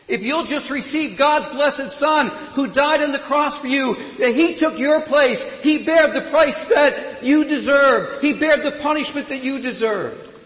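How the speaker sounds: high, loud pulpit proclamation; impassioned preacher driving home each phrase; fervent preaching. Real, not acted. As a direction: preach it at full force, pitch held high. Preaches at full force.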